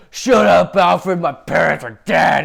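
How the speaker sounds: loud, hammed-up angry outburst; angry mock Batman. Batman snapping at Alfred who warned him, angry. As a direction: yell it back, slowing for the grievance.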